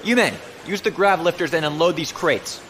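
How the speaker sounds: loud command to the crew; barked order. Acted. Officer shouting orders at his men, loud. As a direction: call the order across the bay.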